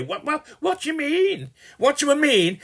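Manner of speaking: high, squawking protest; mock indignation. Acted quarrel over passing by.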